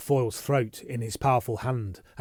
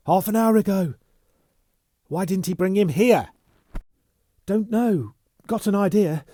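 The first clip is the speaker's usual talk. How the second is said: breathless urgency; 9 semitones higher, 7 dB louder than usual. Acted. Bunny breathless, urgent report.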